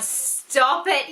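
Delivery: ordinary delivery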